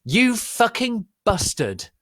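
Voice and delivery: high, loud, slow furious yell. Acted. Draco yelling at Vampire, high slow yell. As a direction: spit it at the rival. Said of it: "yelled Draco".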